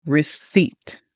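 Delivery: "receipt" with the P left silent, second syllable stressed; slow model "receipt", no p sound. Shown as a demonstration. Coach showing students how receipt should sound, silent P. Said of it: "that P is silent".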